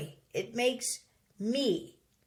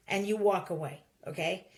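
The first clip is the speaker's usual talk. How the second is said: ordinary talk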